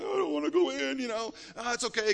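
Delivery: high, put-on child voice, reluctant; lightly voiced crying child; whiny little boy. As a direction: play a scared little boy refusing to go in. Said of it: "he came in crying".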